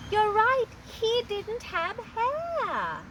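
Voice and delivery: high, slow, loud approval; teacher praise. Shown as a demonstration. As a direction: praise the children's answer. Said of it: "Why do you think".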